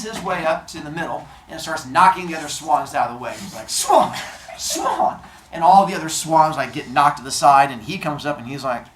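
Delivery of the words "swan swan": high, squawked bird call; pushy swan. Retold or imitated. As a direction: honk the word like a swan. Swan knocking others aside.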